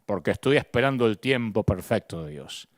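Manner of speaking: low, droning mock answer; mocking excuse. Preacher mocking the waiting believer, low droning mimicry.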